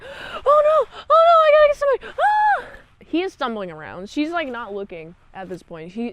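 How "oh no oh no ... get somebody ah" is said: high, loud, fast panicked cry; hammed-up panic, much higher and louder; mock panic. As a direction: shriek in a frantic rush.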